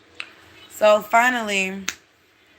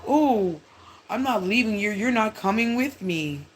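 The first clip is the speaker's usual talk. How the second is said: sly friend; 2 semitones higher than usual. Retold or imitated. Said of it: "He tries that reverse psychology shit".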